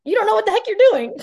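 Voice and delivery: high, loud mocking kid voice; sassy kid. Kid mouthing off to mom, high and loud. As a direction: throw sass at mom like a teen.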